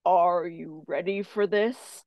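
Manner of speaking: slow, loud, drawn-out question; audible otherworldly voice. Retold or imitated. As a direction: imitate a spirit speaking out loud, slowly. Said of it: "Just like that".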